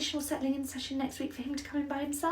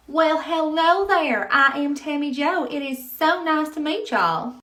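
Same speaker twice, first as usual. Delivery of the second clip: Southern drawl; 3 semitones higher, 8 dB louder, slower than usual. Acted. Tammy Jo, Southern nursery manager.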